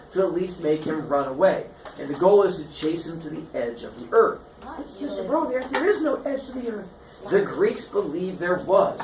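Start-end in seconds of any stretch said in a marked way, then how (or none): 5.0-6.9 high student voice; high-pitched correction from a listener; objecting student. Acted. Acted student objects to the story.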